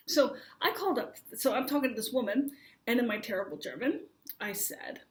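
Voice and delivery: ordinary delivery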